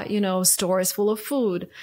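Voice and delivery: ordinary talk, nothing marked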